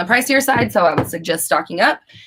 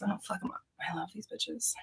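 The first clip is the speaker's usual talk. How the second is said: breathy whisper to herself; whispered fondly to the stones; whispered aside; 2 semitones higher, 19 dB quieter than usual. Real, not acted. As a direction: hush it, talking to the crystals. Putting crystals away, whispering aside.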